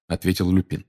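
ordinary delivery

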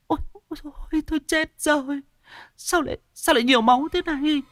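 high horrified woman's wail; terrified wail. A narrator's reading. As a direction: wail as if the world ended. Woman sees blood, despairs.

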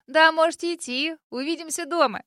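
light, carefree mum voice; breezy and unbothered; carefree mother. A narrator's reading. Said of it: "беззаботно говорит мама".

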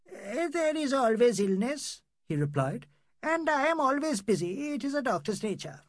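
a hint of puffed-up dignity; pompous doctor. Doctor insists to the visitors he is always busy, mildly pompous. Pannalal defends his busyness.

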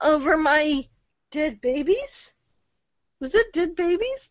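ordinary talk

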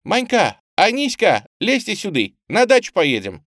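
put-on high, loud girl's call; shouting. A narrator's reading. Girl calling her friends out of the pipe, high shout. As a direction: holler down into a pipe. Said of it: "девочка наклонилась к отверстию трубы и крикнула".

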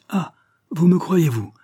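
put-on high-pitched boyish exclamation; triumphant. A narrator's reading. Pupil hearing his tutor take his side, triumphant. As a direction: pounce on it, triumphant. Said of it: "l'air triomphant".

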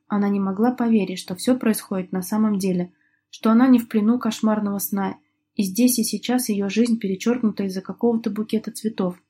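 usual speaking voice

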